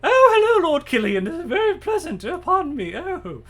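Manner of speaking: high, loud, fluttery society lady voice; a breathless grande dame gushing at her hosts; flustered lady arriving late. Acted. Late lady greeting Lord Killian at supper, high fluttering voice.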